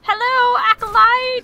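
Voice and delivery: high, loud hello to an enemy; mock greeting. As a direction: call out a playful greeting.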